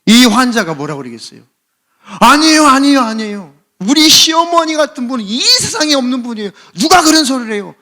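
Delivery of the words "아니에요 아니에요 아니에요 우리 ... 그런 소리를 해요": high, loud, hammed-up protest; gushing, over-the-top denial at high pitch; flustered overreaction. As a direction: deny it with a great fuss. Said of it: "막 호들갑을 떨어요".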